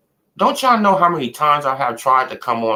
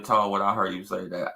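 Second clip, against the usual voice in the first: ordinary delivery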